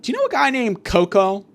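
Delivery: high girlish impression; Tony's daughter. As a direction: lift the pitch for a daughter's question. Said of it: "and the daughter's like".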